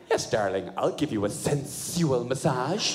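low, slow, deliberately irritating. Retold or imitated. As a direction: put her off with a grating slow croon. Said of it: "I just say it in an irritating way".

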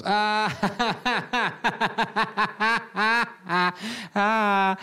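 high, loud forced laughter; put-on belly laugh that goes on too long; fake guffaw. Bluffer joins the press room's laughter, loud fake guffaw. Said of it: "join in with everybody else".

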